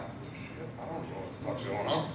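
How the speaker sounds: hushed stunned whisper; whispered aside. Retold or imitated. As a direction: breathe it under your breath, stunned. Narrator's stunned aside next to farmer.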